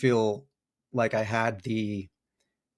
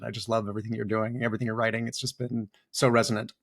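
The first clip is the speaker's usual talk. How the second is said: ordinary talk, nothing marked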